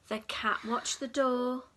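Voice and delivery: usual speaking voice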